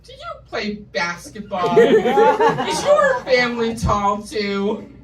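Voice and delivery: loud, slow, nosy prying questions; mock nosy stranger. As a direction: ham up a nosy stranger asking about height.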